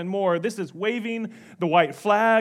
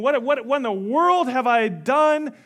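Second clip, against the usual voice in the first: higher, louder mock panic; freaking out; 5 semitones higher, 5 dB louder than usual. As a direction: stammer into an acted panic. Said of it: "he starts freaking out".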